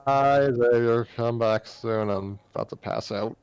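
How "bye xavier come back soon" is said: normal talking voice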